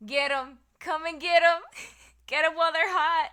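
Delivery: loud, high hype shout to chat; excited calling out. Real, not acted.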